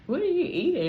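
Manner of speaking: high, loud startled question; a cousin asking in disbelief; cousin's shocked question. Retold or imitated. Cousin shocked at what she's eating. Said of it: "she was like".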